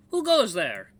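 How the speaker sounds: put-on high, loud old lookout calling out; guard's challenge. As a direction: challenge an unseen intruder in the dark. Guard challenges the hidden intruder.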